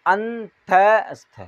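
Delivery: अंतस्थ drawn out very slowly, syllable by syllable; slow demo. Shown as a demonstration. Teacher sounding out अंतस्थ slowly for students. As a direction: stretch अंतस्थ out slowly so the class hears each part. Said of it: "जैसे ही नाम से पता चल रहा है".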